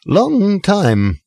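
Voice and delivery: put-on slurred, high drunken storyteller; tipsy pub boast, a touch slurred; drunk Arthur. Drunk Arthur tells his story.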